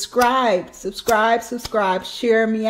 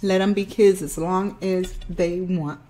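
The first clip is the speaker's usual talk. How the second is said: chewing while talking; eating finger food; mouth partly full; 3 semitones lower, 3 dB quieter than usual. Real, not acted. Seen 3: Eats finger food throughout; lips move as if chewing between phrases.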